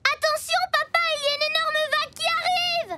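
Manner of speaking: high, urgent call across the beach; warning call. Acted. Child calls to her father about the huge wave. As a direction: shout a warning to papa.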